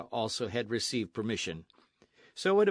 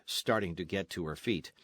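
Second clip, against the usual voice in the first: usual speaking voice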